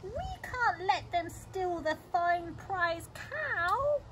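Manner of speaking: high, slow cat wail; meowing cats. A narrator's reading. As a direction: stretch it into a plaintive meow. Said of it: "both the cats began to meow".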